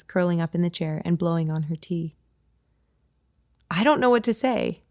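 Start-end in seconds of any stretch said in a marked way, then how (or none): 3.7-4.8 a touch of weak, whiny complaint. A narrator's reading. Kate to her friend, complaining weakly. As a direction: complain feebly. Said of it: "I complain weakly".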